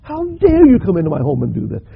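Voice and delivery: loud, high, grief-stricken outcry building from doubt; anguished accusation. Acted. Preacher voicing the widow lashing out at Elijah, loud and high. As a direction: hurl the guilt and anger at the prophet. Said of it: "She's thinking".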